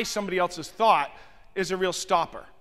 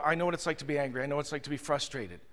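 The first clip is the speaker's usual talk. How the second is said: soft, low, soothing; quiet understanding, trailing softer; calming empathy; 6 semitones lower, 8 dB quieter, faster than usual. Acted. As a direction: ease your voice down to settle someone upset. Demonstrates calming an upset guest.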